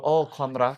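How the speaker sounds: "ความรัก" said in Thai. Shown as a demonstration. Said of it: "You go".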